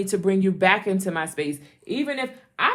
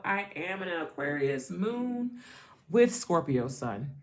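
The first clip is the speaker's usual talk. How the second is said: ordinary talk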